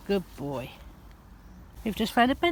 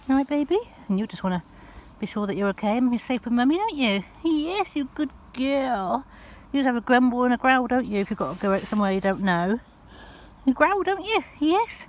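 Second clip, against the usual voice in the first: soothing pet talk; 5 semitones higher than usual. Real, not acted. Mummy soothing her growly dog on a new trail, high cooing reassurance. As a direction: reassure the nervous pup in a sing-song.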